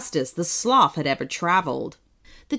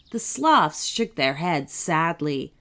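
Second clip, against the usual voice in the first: usual speaking voice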